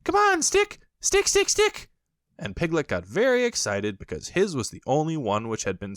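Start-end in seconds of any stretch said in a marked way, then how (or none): 0.0-1.9 very high, loud cheering squeal; high squeaky calling; Roo calling out. A narrator's reading. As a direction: urge the stick on like an eager joey. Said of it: "calling out".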